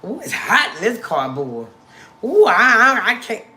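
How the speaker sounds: loud, hammed-up fussing; mock complainer. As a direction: imitate someone who can't take the heat. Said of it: "some people be like".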